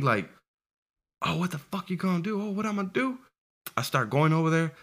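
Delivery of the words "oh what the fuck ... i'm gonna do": higher mocking bully voice; bully daring him to act, high and taunting. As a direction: sneer it back, pitched up.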